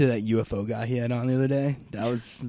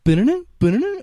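high, loud ba-da-da ringtone; imitated text-message chime; 14 semitones higher, 10 dB louder, faster than usual. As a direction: mimic the phone's alert by mouth. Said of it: "text message theme song".

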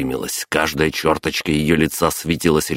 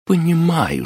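hammed-up higher menacing «Понимаю» with a sharp fall; gritted through teeth; 14 semitones higher than usual. Mother grabbing grandpa's collar, lightly acted gritted menace. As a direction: grind out «Понимаю» as a warning. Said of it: "взяла за шиворот и процедила".

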